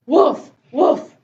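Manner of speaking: short, loud mock woofs; two punchy spoken woofs; puppy bark. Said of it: "What did Biscuit say?".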